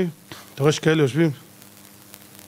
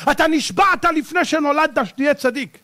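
ordinary talk, nothing marked

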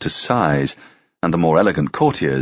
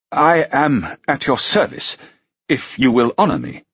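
stiffly; 6 semitones higher than usual. A narrator's reading. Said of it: "he said stiffly".

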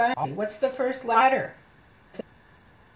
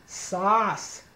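usual speaking voice

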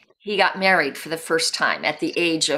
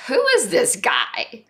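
higher, incredulous question; bemused disbelief; 8 semitones higher than usual. Retold or imitated. Reading a friend's first impression of David, incredulous. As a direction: wonder aloud who on earth he is.

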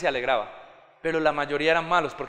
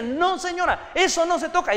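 put-on higher-pitched firm scolding of a little girl; father telling his daughter no, higher; stern parent; 10 semitones higher than usual. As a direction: correct the child firmly. Father correcting his toddler.

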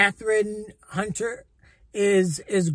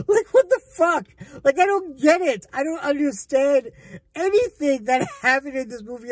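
exasperated bafflement; 5 semitones higher than usual. Real, not acted. Reviewer ranting at a senseless movie plot, exasperated. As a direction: vent total confusion at a raised pitch.

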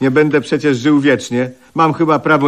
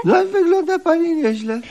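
mock falsetto compliment. Mocking compliment, high.

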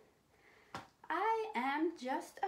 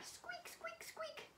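tiny, very high, soft squeaks; mouse squeak; 13 dB quieter, slower than usual. Pretend. Host hinting at a mouse in the pocket, tiny high squeaks. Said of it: "I hear somebody squeaking in there".

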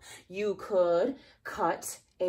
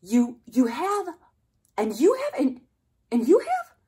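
excited delight; 7 semitones higher, 9 dB louder than usual. Pretend. Reacting to kids' answers.

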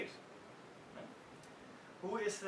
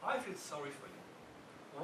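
calmly; 3 semitones lower, 7 dB quieter, slower than usual. Retold or imitated. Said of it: "he says calmly".

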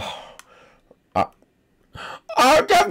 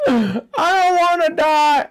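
whiny cry; 9 semitones higher, slower than usual. Acted. Character upset at dying.

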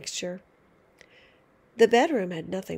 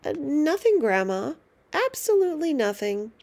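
higher, louder, calling to another room; calling back; 5 semitones higher, 4 dB louder than usual. Acted. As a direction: shout back innocently to the next room. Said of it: "he called back".

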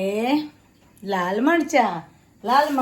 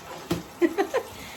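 breathy high giggle; soft, high-pitched burst of laughter; 9 semitones higher, 6 dB quieter than usual. Real, not acted. Daughter joking that only she is talkative, soft giggle.

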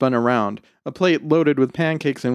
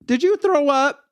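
put-on very high little-kid voice; high-pitched child's question; six-year-old girl. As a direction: pipe up like a small child. Six-year-old twin asks.

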